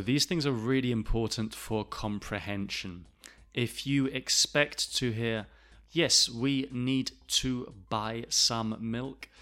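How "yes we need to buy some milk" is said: slow, every word fully pronounced; unnaturally separated words, slow; word-by-word textbook reading; textbook over-careful speech. Shown as a demonstration. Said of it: "not normally hear people speaking like this".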